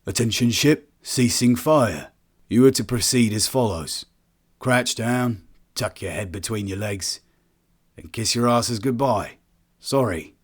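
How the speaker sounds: lightly clipped English accent, slow and mocking; a hint of a dry English officer, toying with them. Colonist replies over the comms in an English accent. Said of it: "voiced by a man with a well-defined English accent".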